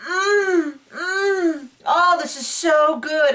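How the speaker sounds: high, drawn-out mock yum; mock moaning foodie. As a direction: imitate Kenya hamming up the taste. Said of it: "where Kenya's like".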